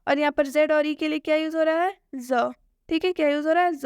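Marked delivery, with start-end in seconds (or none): none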